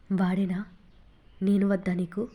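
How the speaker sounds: ordinary delivery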